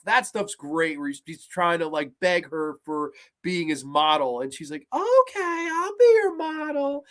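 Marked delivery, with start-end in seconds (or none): 4.9-7.0 put-on high, slow coy girlish voice; coy high mock-girl voice; Tomie voice. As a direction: purr it up high like a teasing girl. Voicing Tomie agreeing coyly.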